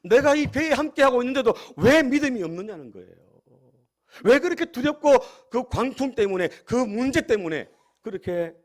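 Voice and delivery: high, loud, impassioned rebuke; preacher's forceful acted scolding. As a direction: press the reproach hard, voicing an indignant master. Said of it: "제자들을 또 꾸짖으십니다".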